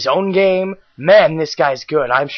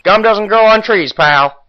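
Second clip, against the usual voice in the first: gangster cop; 5 semitones higher, 4 dB louder than usual. Acted. Voicing detective Gumshoe.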